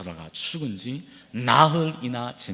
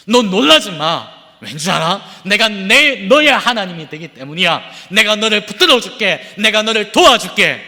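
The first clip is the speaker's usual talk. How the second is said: louder, emphatic promises of God; fervent preaching; 8 semitones higher, 9 dB louder than usual. Acted. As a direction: proclaim it with conviction. God's promise preached loud. (확신에 찬 외침)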